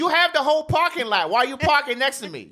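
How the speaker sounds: exasperated, voice jumping up high. Real, not acted. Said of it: "You have my voice just raised real quick?".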